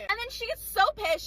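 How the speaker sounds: ordinary delivery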